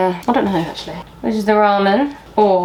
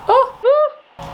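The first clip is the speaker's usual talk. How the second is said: excited whoop; 4 dB louder than usual. Real, not acted. Cheering herself with music.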